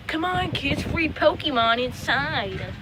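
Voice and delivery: high, slow sing-song lure; creepy clown lure. Retold or imitated. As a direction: coax kids like a creepy clown.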